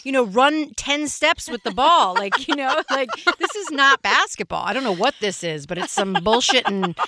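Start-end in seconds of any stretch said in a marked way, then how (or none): 0.0-4.6 retold yell at ref. Softball mom retelling yelling at the referee, higher mock yell. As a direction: call out the ref like an angry sideline mom. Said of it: "yelling at the ref".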